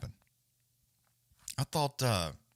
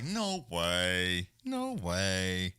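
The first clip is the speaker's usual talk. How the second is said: drawn-out, level-toned catchphrase imitation; long held vowels, mock disbelief; reels guy catchphrase; 2 semitones lower, much slower than usual. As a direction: mimic a viral clip guy, stretching each word. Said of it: "What's the guy on the fucking reels or shorts? He's like".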